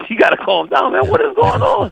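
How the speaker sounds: exasperated retelling that leaps way up; high-pitched retold plea. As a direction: voice climbing very high. Retelling himself amid the brawl.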